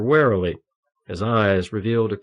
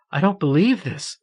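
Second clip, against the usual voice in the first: higher, sobbing cry of disbelief; 9 semitones higher than usual. A narrator's reading. Marion sobbing over her healed friend, disbelieving. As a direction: sob it out, shaken. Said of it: "The strength of her sobs doubled her over".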